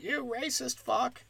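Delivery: high, slow heckle without volume; mock distant yell. Passerby heckling comics from the street, mock yell. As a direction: imitate a shout from down the street. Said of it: "can yell at us from the street".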